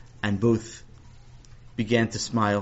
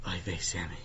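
whispered exasperated oy vey; 3 semitones lower, 9 dB quieter than usual. Acted. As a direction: breathe it out in despair. Teacher sighs at Sammy.

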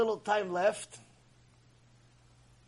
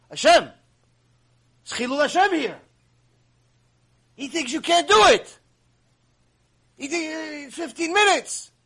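urgent plea; 8 semitones higher, 6 dB louder than usual. Acted. Nakdimon pleading with Hashem, acted.

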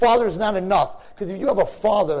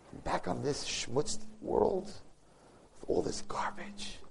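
slow, soft whisper of letdown; disappointed whisper; 3 semitones lower, 11 dB quieter, much slower than usual. Acted. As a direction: breathe it out, deflated. Mourning the fast's end.

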